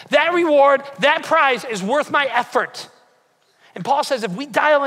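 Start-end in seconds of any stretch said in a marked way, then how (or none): 0.1-2.9 louder repeat. Acted. Preacher to congregation, repeating the line louder. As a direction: repeat it harder, climbing to a loud peak.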